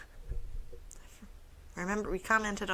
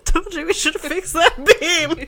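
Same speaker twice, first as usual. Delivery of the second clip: laughing; 9 semitones higher, 13 dB louder than usual. Acted. Quoting a movie line while laughing.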